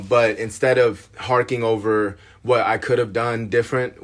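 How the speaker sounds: usual speaking voice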